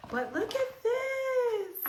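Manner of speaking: very high, slow squeal; gushing squeal. Real, not acted. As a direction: gush over it with a squeal. Squeals showing product.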